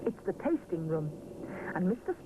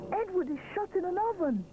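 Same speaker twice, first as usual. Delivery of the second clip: urgent news; 12 semitones higher than usual. A narrator's reading.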